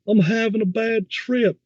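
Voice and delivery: high-pitched, stretched-out taunting echo; mocking mimic. Friend parroting a man's bad trip plea, mocking. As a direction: parrot it back slowly, way up high, accent put on. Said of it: "mimicking me and adding a little accent to it".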